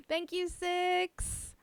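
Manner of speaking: usual speaking voice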